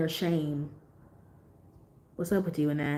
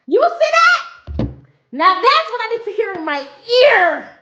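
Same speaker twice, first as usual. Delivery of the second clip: swooning; 13 semitones higher, 6 dB louder than usual. Real, not acted. Swooning over a deep voice.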